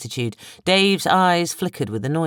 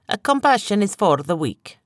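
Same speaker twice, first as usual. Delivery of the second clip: haughty; 6 semitones higher than usual. A narrator's reading. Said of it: "Barnes replied haughtily".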